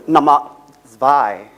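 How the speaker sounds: "Nummer zwei" in German, zwei dropped low; student speaker counts the second point in German; German counting. Retold or imitated.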